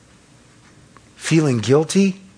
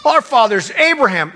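proud Pharisee; 6 semitones higher than usual. Retold or imitated. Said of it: "how he talks to the Pharisees".

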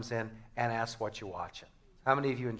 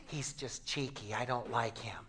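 mock grumble; 3 semitones lower, 10 dB quieter than usual. Voices alienated listeners' grumble.

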